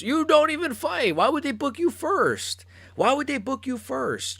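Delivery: incredulous high-pitched outburst; ranting. Real, not acted. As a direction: voice climbing in disbelief at lazy fighters. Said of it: "Sorry for the little rant".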